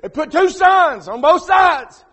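slow and loud, hammer each word; slow preaching shout. Real, not acted. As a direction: shout it out slowly. Preacher emphasising the signs.

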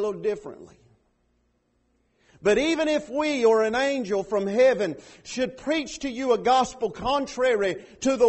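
loud, driving sermon delivery; fiery preaching. Acted. Pastor preaching at full force to the church. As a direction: preach it hard to the congregation.

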